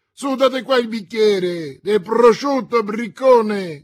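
molto più alto e forte, chiama l'oste; gridando. A narrator's reading. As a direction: grida all'oste a piena voce. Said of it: "gridò Athos". (Athos ubriaco chiama l'oste, gridando)

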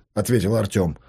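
usual speaking voice

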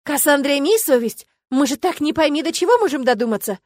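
exasperated plea to a friend; exclaiming reproach. A narrator's reading. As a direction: exclaim it, out of patience. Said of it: "воскликнула Матильда".

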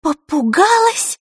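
high, loud, slow indignant echo; outraged disbelief. Acted. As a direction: throw the word back, outraged. Outraged girl echoes word.

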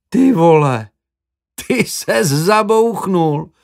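drawn out, like catching a friend in love; surprised. Acted. Roommate realizing his friend fell in love, surprised. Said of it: "překvapeně pojmenoval Tomáš".

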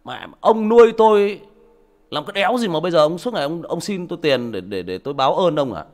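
high, loud furious outburst; angry son. Retold or imitated. Grown son yelling at his father, furious. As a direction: yell at the father in rage. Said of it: "nó bảo".

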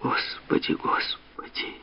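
whispered, slowing moan. A narrator's reading. Mother despairs at the quarrel, moaning in a whisper. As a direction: whisper it as a drawn-out, fading lament. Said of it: "застонала мать".

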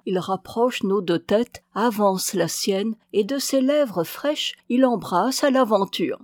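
plain reading voice

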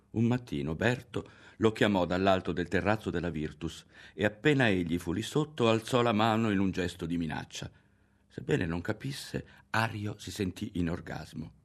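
plain reading voice

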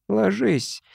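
a lightly voiced dead wife's command. A narrator's reading. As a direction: order him to lie down. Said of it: "скомандовала Ленка".

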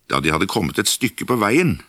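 ordinary delivery, nothing marked

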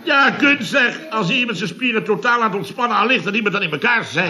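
high-pitched comic indignation; indignant protest. Acted. Chef defending his collapse on the couch, high and indignant.

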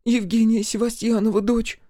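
put-on a scared village girl answering; timid high young girl; frightened girl. A narrator's reading. Frightened girl voiced by narrator.

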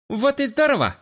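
bright, high delighted voice; light up with joy; beaming. A narrator's reading. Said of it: "просияла блондинка".

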